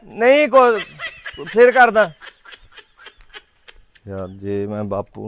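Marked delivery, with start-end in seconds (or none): 0.1-2.1 nervous backing out. Acted. Son backs out, scared.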